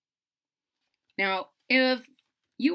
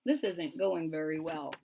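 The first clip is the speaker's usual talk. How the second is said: quiet wry aside; 7 semitones lower, 6 dB quieter than usual. A narrator's reading.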